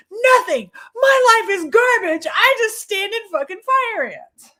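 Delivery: high, loud mock-tantrum yell; exasperated outburst. Coach snapping back at client's question, exasperated yell. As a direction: wail it back loudly, high-pitched.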